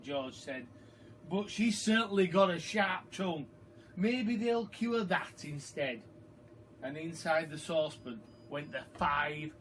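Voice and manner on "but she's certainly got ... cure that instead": sly joke. A narrator's reading. George jokes about grandma's sharp tongue, sly and teasing. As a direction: tease it like a cheeky boy.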